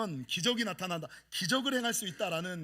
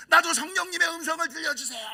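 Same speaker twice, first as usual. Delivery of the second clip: higher, louder plea; begging prayer; 8 semitones higher, 5 dB louder than usual. Retold or imitated. Praying to hear the Spirit's voice, pleading. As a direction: plead like a child.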